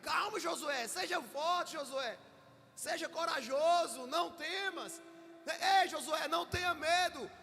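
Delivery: rally him, ending in a shout; God calling out. Acted. God rallying Josué, building to a shout. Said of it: "Aí ele".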